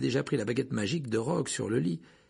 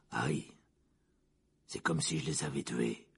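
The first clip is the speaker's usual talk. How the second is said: low, soft rasping confession; hoarse voice; 10 semitones lower, 6 dB quieter than usual. A narrator's reading. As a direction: rasp it out quietly. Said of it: "de sa voix rauque".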